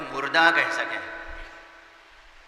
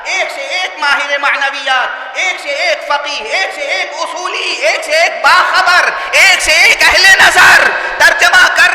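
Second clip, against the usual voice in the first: high, chanting list that swells to loud; building oratorical preaching; 9 semitones higher, 9 dB louder than usual. Real, not acted. Preacher listing scholar after scholar to the congregation, building oratorical preaching. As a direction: hammer each repeated phrase, building to full force.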